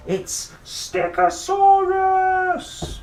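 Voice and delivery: high, slow, thrilled announcement; excited exclaim. Pretend. Reader thrilled at the stick dinosaur. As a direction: shout the dinosaur name with delight.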